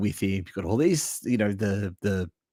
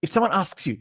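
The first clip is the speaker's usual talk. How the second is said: ordinary talk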